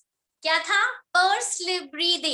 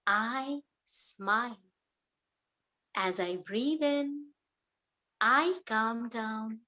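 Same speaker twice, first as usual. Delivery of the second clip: guided meditation; 2 semitones lower, slower than usual. Acted. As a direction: calmly lead the class through each breath.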